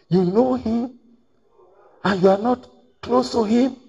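high disbelieving question; astonished question. Retold or imitated. People questioning the brother about his pastor, high astonished question. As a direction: sound amazed at the answer.

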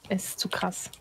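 hushed whisper. Real, not acted. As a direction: breathe it out softly. Aim sagging, hushed.

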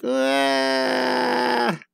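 high crying wail; a cartoon wail of grief; high-pitched fake wail; mock crying wail. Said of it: "this guy's like".

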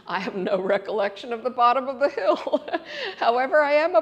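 amused, breaking into a laugh. Real, not acted. Speaker recalling her downhill bike ride, laughing as she talks. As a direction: let laughter burst through the telling.

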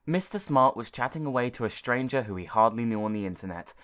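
usual speaking voice

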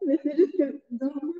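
ordinary delivery

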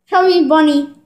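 talking through stuffed cheeks; mouth full. Real, not acted. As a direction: say it round a mouthful of marshmallows. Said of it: "we're each gonna have a marshmallow in our mouth".

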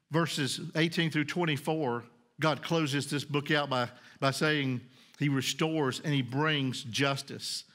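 ordinary talk

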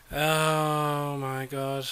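slow, stunned groan of disbelief; drawn-out disbelief. Real, not acted. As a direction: stretch "Oh my god" out very slowly. Tree stuck in bus.

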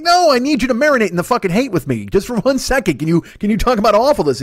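high, pleading whine to share the hate; pleading outrage. Retold or imitated. Begging his friend David to share the outrage, high and whiny. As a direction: beg him to stew in it with you. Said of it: "And I'm just like".

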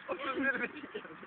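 laughing hard; wheezing with laughter. Real, not acted. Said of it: "nefes alamıyorum lan".

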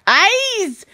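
slow, drawn-out mock German; bad German accent. Taking a German accent challenge, slow bad German accent. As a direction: stretch every word in fake German. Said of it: "that's meant to be German".

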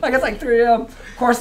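normal talking voice

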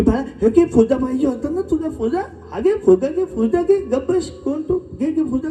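high-pitched, insistent coaxing; coaxing shopkeeper. Acted. Vendors offer the lost boy a balloon, high urgent coaxing. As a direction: press the balloon on a crying child. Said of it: "प्रत्येकाने विचारलं की".

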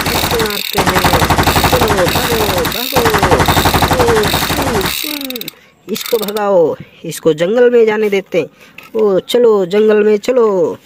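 normal talking voice

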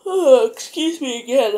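talking through a yawn. Real, not acted. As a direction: yawn stretching the words. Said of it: "I don't know why I'm yawning".

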